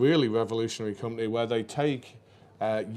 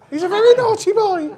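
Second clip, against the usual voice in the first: much higher, louder shrill-mother falsetto; Python mum falsetto; 11 dB louder than usual. Retold or imitated. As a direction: squeak it out like the Python mum in drag. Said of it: "I've got to do this for the Messiah".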